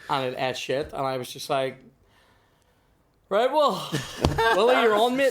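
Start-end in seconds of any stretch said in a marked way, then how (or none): 3.3-5.3 flustered handoff. Retold or imitated. Bailing out, handing stage over.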